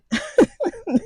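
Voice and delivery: high burst of real laughter. Real, not acted. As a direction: laugh out loud at the memory. Laughs at his own memory.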